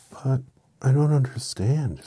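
high, loud, slow puzzlement; bewildered. A narrator's reading. Dorothy puzzling over Oz's confession, slow bewildered voice. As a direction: draw it out, high and baffled. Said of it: "said Dorothy in a bewilderment".

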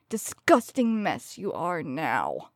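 normal talking voice

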